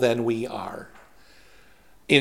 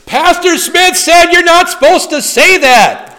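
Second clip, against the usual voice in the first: high, loud, whiny mock tattling; mock tattletale; 9 dB louder than usual. Preacher mocking a sermon listener who tattles, high and loud. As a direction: mimic someone tattling to the pastor.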